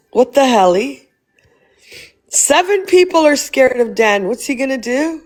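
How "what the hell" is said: mock-cute "helly" with a lilting rise; playful cutesy twist. Shown as a demonstration. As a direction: turn the swear into a silly baby word.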